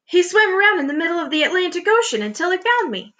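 high, excited storybook exclamation; gasped amazement. A narrator's reading. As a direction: tell it in wonder, pitched high. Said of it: "gasped the centipede".

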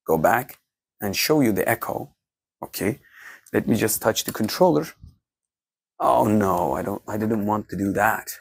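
ordinary delivery

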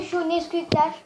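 usual speaking voice

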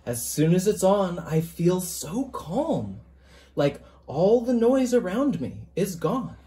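gentle picture-book reading, even and measured; story narration. A narrator's reading. Author reading his picture book to listeners, storytelling voice. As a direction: read it aloud to a class of children.

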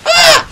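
high, loud sudden yelp; startled scream. Real, not acted. Killed without warning, startled shriek. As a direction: shriek as you get hit.

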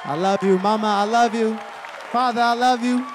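shouted over applause; loud heartfelt thanks. Real, not acted. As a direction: call love to the crowd. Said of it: "emotional thank-yous shouted".

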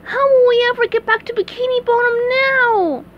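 very high cartoon wail; SpongeBob in dismay. A narrator's reading. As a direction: wail in hammed-up despair.

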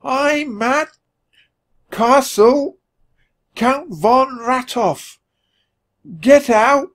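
very high, very slow squeak; high, slow frightened squeak down a bad line. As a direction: squeak it out from far away. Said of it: "he squeaked".

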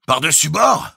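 high, loud shout into a tube; shouted question. A narrator's reading. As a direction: yell the question up the pipe. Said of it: "pour crier dans le tuyau". (question criée dans le tuyau)

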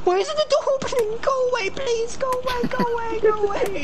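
high, fast frightened begging; terrified squeaky plea; panicked pleading. Real, not acted. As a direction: beg the zombies to leave in real panic. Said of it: "He's crying like a Brit".

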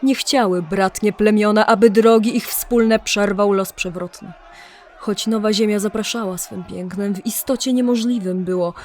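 ordinary delivery

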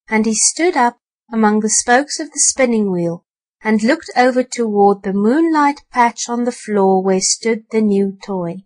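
ordinary delivery

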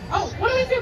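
ordinary talk, nothing marked

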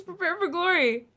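high, insistent quote; mock battle cry. Streamer telling the guys that's the quote, mock battle cry. Repeats quote amid laughing protest.

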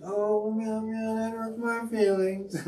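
very high, slow meowing kitty; meowing cat voice. Retold or imitated. Streamer voices his meowing cat in a very high voice. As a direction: whimper it like a hurt kitten.

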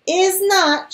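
high, loud retort; shouted. A narrator's reading. Queen Lennox shouts back at King Jonah, loud and high. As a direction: shout it right back at him. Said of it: "shouted Queen Lennox".

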